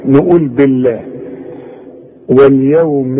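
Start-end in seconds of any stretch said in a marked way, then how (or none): 0.5-1.0 بالله with a light لام; the لام of بالله kept thin after kasra; thin Allah. Shown as a demonstration. Teacher recites بالله with a thin لام. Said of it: "بنرقق لفظ الجلالة".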